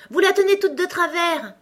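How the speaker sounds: high, loud cry of alarm; cried warning. A narrator's reading. Alice seeing the queen's brooch held crooked, urgent cry. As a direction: call it out urgently, pitched right up. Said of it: "cria Alice".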